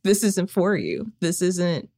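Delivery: usual speaking voice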